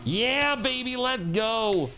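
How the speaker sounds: high, drawn-out victory cry; stretched, high cheer; triumphant cheer. Real, not acted. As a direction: celebrate the win. Wins fight in game.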